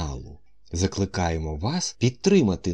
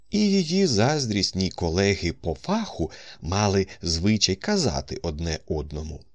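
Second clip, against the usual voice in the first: plain reading voice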